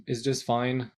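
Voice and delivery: ordinary talk, nothing marked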